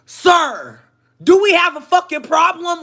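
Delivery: high, loud hostile challenge; confrontational. Retold or imitated. To the coworker through the door, a loud hostile challenge. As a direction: square up to her. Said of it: "It sounded like".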